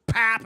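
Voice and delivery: high, loud smack sound; sudden mock impact pop; imitated punch noise. Imitates uppercut landing.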